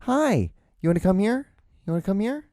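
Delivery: high, cooing talk to a dog; pet talk. Real, not acted. Greeting a beloved little dog, high-pitched coaxing. Talking sweetly to her dog.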